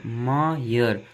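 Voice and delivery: ordinary talk, nothing marked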